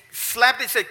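ordinary talk, nothing marked

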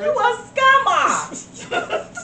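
very slow, high, sing-song "You a scammer."; drawn-out accusation. Acted. Mocking a phone scammer, "You a scammer." stretched slow.

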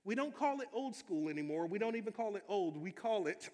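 usual speaking voice

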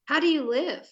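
high, loud disbelief; incredulous. Real, not acted. Woman hearing he gave up chocolate and soda, incredulous. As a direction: exclaim it in real disbelief. Teasing disbelief at his diet.

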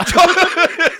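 high, loud burst of laughter. Real, not acted. Laughing at the Josh off pun, a loud high laugh. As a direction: crack up at the pun.

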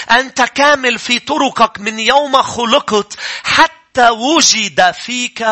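slow, drawn-out verse; slow solemn reading; slow scripture reading. Shown as a demonstration. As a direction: stretch every word of the verse. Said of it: "حزقيال اثنين وعشرين".

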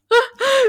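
high, sudden giggle; short loud high laugh; laugh at a stumble. Real, not acted. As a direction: giggle at your own slip.

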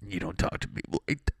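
voiceless mock-weepy complaint; badly faked tearful protest; mock crying. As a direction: pretend to complain through tears, voice mostly gone. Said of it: "Dude, just crying".

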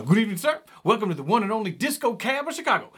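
high, loud, quick announcer greeting; showman cab driver. Retold or imitated.

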